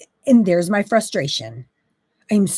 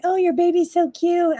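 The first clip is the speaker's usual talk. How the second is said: higher, gushing cooing voice; cooing compliment; 8 semitones higher than usual. Pretend. Friend gushing over the new baby, higher cooing voice. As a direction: coo it like a doting friend. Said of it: "call me and say".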